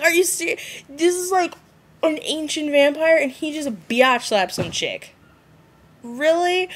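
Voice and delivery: high, disbelieving rant; incredulous. Retold or imitated. To the viewers about the slap, high incredulous rant. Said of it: "I was like".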